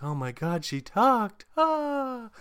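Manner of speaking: high, rising gasp of astonishment; hammed-up squeal of wonder; mock amazement. Mock amazement at girl talking.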